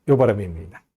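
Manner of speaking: usual speaking voice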